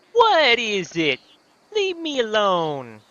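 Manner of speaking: loud, high, drawn-out Brooklyn whine; hammed-up irritated Brooklyn accent. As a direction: gripe slowly in an exaggerated Brooklyn accent. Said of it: "Gave him the Brooklyn accent".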